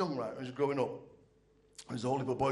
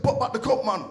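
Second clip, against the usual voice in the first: vexed dad; 9 semitones higher, 8 dB louder than usual. Retold or imitated.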